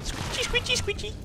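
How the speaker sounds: very high, soft squeaky patter; tiny high-pitched squishing sounds, half under the breath; squeaky squelch voice. Pretend. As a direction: squeak it softly, like squishy worms. Making squelchy noises for worms.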